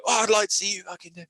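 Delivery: put-on huffy voice; mock aggressive. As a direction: mimic his affronted challenge. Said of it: "You've come over being really aggressive".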